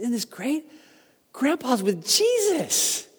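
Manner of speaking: put-on high, slow, innocent child voice; a little girl comforting her grandma, high and slow; excited little girl. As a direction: sound like a small child sharing happy news.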